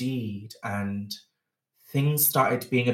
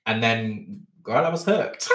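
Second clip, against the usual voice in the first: usual speaking voice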